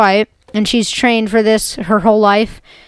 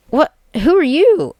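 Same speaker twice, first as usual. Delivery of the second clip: high, startled who-are-you; a kid voicing startled townsfolk; surprised strangers; 7 semitones higher than usual. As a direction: jump up in pitch, caught off guard. Whoville people meeting strangers.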